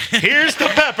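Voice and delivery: high, loud sign-off yell; shouted catchphrase. Acted. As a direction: belt the catchphrase.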